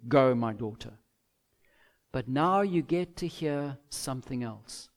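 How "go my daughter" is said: a low, terse go-ahead with nothing added; just let her go, no warmth; flat, minimal dismissal; curt, bare permission. Pretend. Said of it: "And simply".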